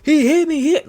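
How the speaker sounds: football player mockingly screaming that he got hit; mock scream. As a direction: play a whiny player crying to the ref. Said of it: "get up and scream".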